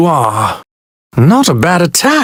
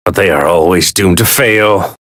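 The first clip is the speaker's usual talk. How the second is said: ordinary talk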